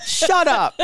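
high, falling shut up, fed up; exasperated shut up. Real, not acted. Co-host mocking a cheesy Instagram post, dismissive high shut up.

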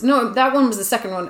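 normal talking voice

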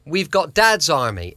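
usual speaking voice

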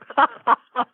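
short high-pitched laugh; a pleased little high laugh. Real, not acted. After bragging about his named day, short high laugh.

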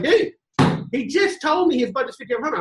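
incredulous protest. Retold or imitated. Billy balks at quoting above the client's budget, high disbelief. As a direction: push back like it's crazy. Billy objecting to higher price.

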